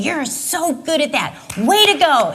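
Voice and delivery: mock proud coach cheering. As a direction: praise a kid on the sidelines. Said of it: "someone saying".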